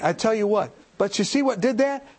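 ordinary talk, nothing marked

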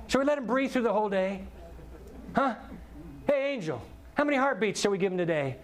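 God cheerfully chatting with an angel; voicing God. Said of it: "he says".

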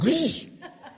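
sudden high burst of sound; imitated spray noise. Preacher mimics the mess happening, imitated spray noise. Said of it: "It went".

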